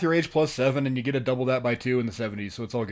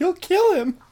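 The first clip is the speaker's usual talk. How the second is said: mock alarm; 6 dB louder than usual. Co-host quotes film line.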